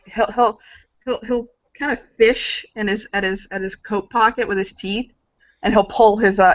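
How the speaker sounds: ordinary talk, nothing marked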